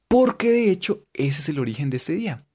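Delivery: ordinary talk, nothing marked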